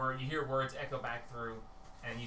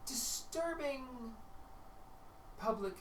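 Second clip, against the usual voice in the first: Tia's high voice; 5 semitones higher, 5 dB quieter than usual. Acted. Tia answering the Justicer, high and hesitant. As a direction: stall in a high silly voice.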